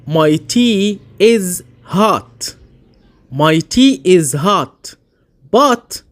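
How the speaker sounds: my tea is hot, word by word, very slow; slow example. Shown as a demonstration. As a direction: spell it out slowly for learners. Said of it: "مثال". (جملة my tea is hot ببطء شديد)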